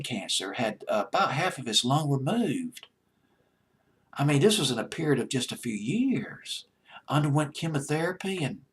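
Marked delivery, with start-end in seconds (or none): none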